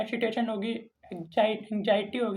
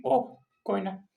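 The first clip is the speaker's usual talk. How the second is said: dismissive reply. Retold or imitated. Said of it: "ऐसे नहीं बोलना है".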